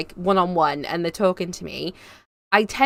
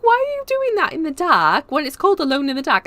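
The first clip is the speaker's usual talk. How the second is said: high-pitched mock disbelief; retold incredulity; 8 semitones higher, 4 dB louder than usual. Daughter asking mum about her horror game, incredulous. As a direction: question it in a high, baffled voice, acting it out.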